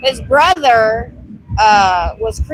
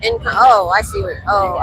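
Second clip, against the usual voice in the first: ordinary talk, nothing marked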